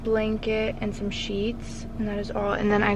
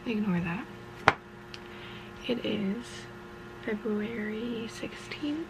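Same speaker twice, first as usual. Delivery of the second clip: whitening strips in, hushed; soft talk around whitening strips; with whitening tray; 7 dB quieter than usual. Real, not acted. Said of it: "so if I'm talking funny".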